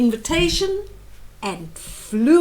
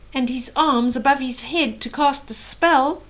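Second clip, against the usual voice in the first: ordinary delivery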